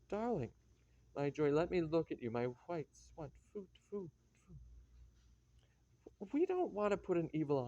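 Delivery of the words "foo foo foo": mock spitting. Spitting to ward evil eye.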